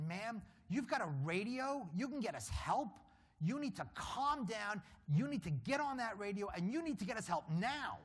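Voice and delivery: commanding a crying woman to use the radio; urgent command. Retold or imitated. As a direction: talk her out of panic, loud and firm. Urging panicked guard on radio.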